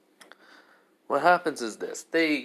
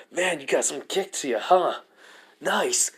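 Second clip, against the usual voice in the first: higher, louder, eager praise of the foe; thrilled taunt; 5 semitones higher, 5 dB louder than usual. Acted. Belle blocks the Minotaur's blow and enjoys it, excited taunt. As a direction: relish the fight.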